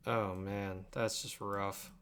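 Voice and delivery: ordinary delivery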